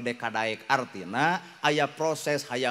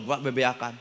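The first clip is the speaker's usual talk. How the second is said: normal talking voice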